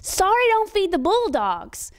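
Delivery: high, slow, drawn-out comeback; a kid throwing his father's words back, high and slow; defiant child retort. Retold or imitated. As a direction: fire the saying back at him, high and slow. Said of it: "I just looked at him and I said".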